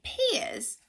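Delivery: usual speaking voice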